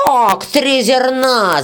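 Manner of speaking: slow, high outraged shout. Acted. Red-haired peasant shouts at the beetle over wages. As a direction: yell it in disbelief. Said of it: "закричал рыжий мужичок".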